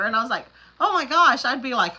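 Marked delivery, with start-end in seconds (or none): 0.8-1.4 loud, high gasp of mock shock; oh my gosh. Telling her sister about the heat, a loud high shocked gasp. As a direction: react as if the news floored you.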